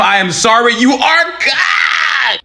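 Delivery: high, slow shout stretching "cooked"; drawn-out mock wail. Mocking dream believers, a slow, high mock wail. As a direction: stretch the doom out in a mock howl.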